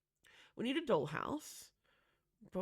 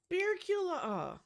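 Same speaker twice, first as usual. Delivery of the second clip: "Beercula" cried out high and loud, slowed; delighted gasp; 11 semitones higher, 8 dB louder, slower than usual. Real, not acted. Player discovering the Beercula toy, delighted cry. Gasps at a found item.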